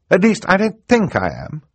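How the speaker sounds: put-on high, loud girl's voice; testy reply, pitched well up. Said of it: "Violet said testily".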